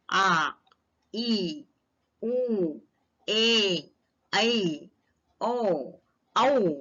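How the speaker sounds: each Hindi long vowel आ ई उ ए ऐ ओ औ held for two beats, one at a time; long vowels drawn out. Shown as a demonstration. Teacher showing pupils the seven long vowels, held long. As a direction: stretch every vowel, pausing between them. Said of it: "सभी दीर्घ स्वर सात आ गए हैं इनमें".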